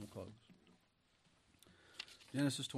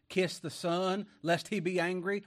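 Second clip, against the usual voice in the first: higher, louder proclaiming of the verse; preaching at full force; 5 semitones higher, 6 dB louder than usual. Acted. Preacher urging the church with scripture, high and forceful. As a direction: call it out over the congregation.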